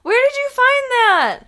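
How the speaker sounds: high, loud and drawn-out squeal; excited squeal. Real, not acted. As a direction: gush with delight, slowly. Squeals over a find.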